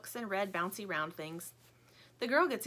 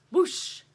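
high, loud spoken whoosh; 9 semitones higher, 9 dB louder than usual. A narrator's reading. Backpack swings out the door, big whoosh. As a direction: swoosh it out big.

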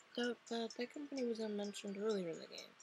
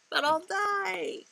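high, loud, drawn-out wail; hammed-up terror of a doomed soldier; panicked protest; 10 semitones higher, 9 dB louder, much slower than usual. Soldier protesting order to die, panicked.

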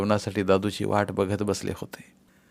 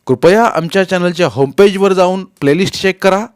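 loud, raised-pitch promotional read; channel announcer; 7 semitones higher, 10 dB louder than usual. Real, not acted. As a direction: announce it to the listeners, high and projected. Channel announcement after the story. (चढ्या आवाजातील जाहीर निवेदन)

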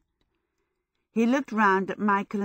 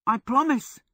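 willing promise, higher in pitch; eager-to-comply, higher voice; 6 semitones higher, 4 dB louder than usual. A narrator's reading. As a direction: agree readily, voice lifted. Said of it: "Michael said willingly".

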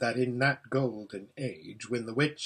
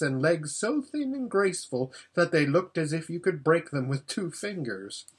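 ordinary delivery

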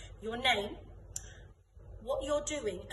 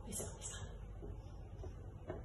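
ordinary talk

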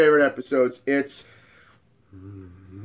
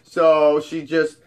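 ordinary talk

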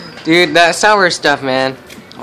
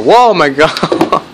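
laughing surprise; 3 semitones higher, 4 dB louder, much slower than usual. Real, not acted. Friend chugs sour drink.

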